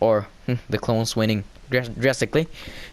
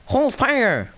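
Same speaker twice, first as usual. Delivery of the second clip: high-pitched mock droid order; droid command; 14 semitones higher than usual. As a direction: imitate a droid calling out, very high. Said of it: "the droid says".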